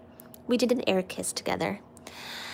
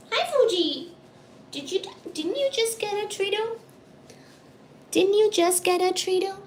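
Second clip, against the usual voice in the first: high pet talk; 8 semitones higher than usual. Real, not acted. Cooing at the cats.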